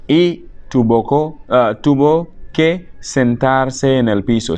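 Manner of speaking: "tuvo que sentarse" stretched, word by word; slow dictation. Shown as a demonstration. Teacher repeats the example for learners, slow and segmented.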